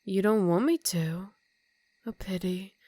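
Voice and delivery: normal talking voice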